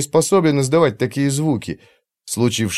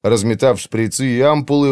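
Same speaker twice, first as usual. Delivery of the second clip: plain reading voice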